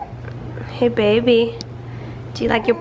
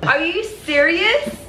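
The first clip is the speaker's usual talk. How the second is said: surprised; 6 semitones higher, much slower than usual. Real, not acted. Surprised at a Christmas present.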